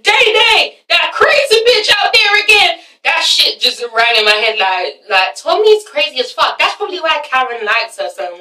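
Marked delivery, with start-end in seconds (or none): 0.0-2.8 high, loud mimicked holler; imitating an alarmed shout; mock yell. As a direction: call it out high, as if alerting someone. Re-enacts a line stuck in head.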